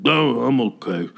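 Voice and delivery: slow, slurred mumble; drawn-out mock mumbled reassurance; calmed-down stranger. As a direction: imitate the stranger finally coming round. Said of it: "came to his senses".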